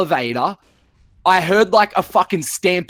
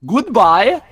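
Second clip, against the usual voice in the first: higher, louder chirpy goodbye; cheery goodbye; 6 semitones higher, 5 dB louder than usual. Retold or imitated. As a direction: sing out a breezy farewell. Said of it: "like that".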